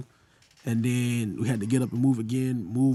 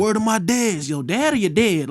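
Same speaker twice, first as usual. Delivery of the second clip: higher, punchy mock New York slang; mocking New York accent; 6 semitones higher, 4 dB louder than usual. Southerner clowning New Yorkers back, mock New York accent. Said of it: "I used to clown them".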